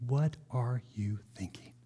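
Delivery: unvoiced incredulous whisper. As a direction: whisper it in disbelief. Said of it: "Habakkuk says".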